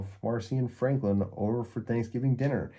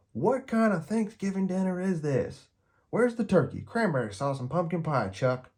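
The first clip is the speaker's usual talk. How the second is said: indignant Peppermint Patty; 10 semitones higher than usual. A narrator's reading. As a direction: demand the missing turkey, outraged. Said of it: "Peppermint Patty frowns".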